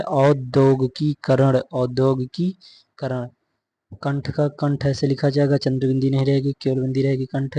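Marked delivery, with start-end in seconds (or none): 0.0-3.3 औद्योगिकीकरण drawn out syllable by syllable; slow careful demonstration. Shown as a demonstration. Teacher showing students the correct form, slow and segmented. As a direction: say औद्योगिकीकरण slowly, in separate chunks. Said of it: "शुद्ध रूप क्या है".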